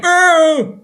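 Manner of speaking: sudden high yelp of surprise; startled shout. Retold or imitated. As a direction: blurt out a startled oh. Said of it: "I think I went".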